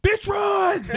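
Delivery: very high, full-volume yell; mock sideline scream. Parent to daughter on the field, comic screamed order. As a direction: scream it as an over-the-top sports parent.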